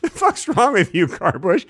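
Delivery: high, mock-appalled outburst; exasperated protest. Insulted driver answers the bush, mock exasperation. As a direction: react in hammed-up exasperation.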